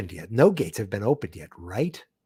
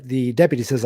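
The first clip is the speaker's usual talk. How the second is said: usual speaking voice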